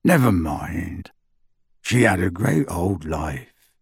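low and slow, gruff but kind; rough comfort, taken slowly; a gentle giant's low consolation; gruff Hagrid. A narrator's reading. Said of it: "he said gruffly".

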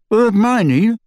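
lightly muffled through full cheeks; mouth full. A narrator's reading. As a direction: talk around a bite of food. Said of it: "with his cheeks bulging".

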